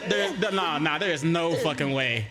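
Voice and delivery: usual speaking voice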